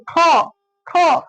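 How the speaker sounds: ख said with a clear puff of breath; ख, ख with audible breath after the k; aspirated kha. Shown as a demonstration. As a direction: demonstrate the aspiration in ख. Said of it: "ख का उच्चारण कीजिए".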